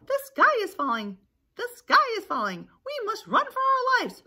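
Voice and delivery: high, loud alarm cry; hammed-up panic. Chicken Little thinks the sky is falling, panicked cry. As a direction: raise the alarm like a frantic cartoon chick.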